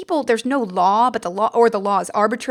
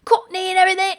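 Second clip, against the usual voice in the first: mock Cockney accent, pitched higher; 9 semitones higher, 3 dB louder, faster than usual. Shown as a demonstration. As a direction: put on a jokey Cockney accent. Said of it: "really thick accents and talk like this".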